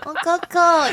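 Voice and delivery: put-on high, sweet clip-voice girl; cartoon princess. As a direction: coo it like a cutesy girl. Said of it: "就是夹子音说".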